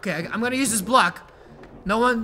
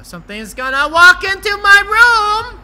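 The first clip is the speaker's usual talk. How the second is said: rising, loud panicky cry; hammed-up fear of what's coming; playful dread; 10 semitones higher, 12 dB louder than usual. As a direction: build to a high yell. Dreads something entering the game room.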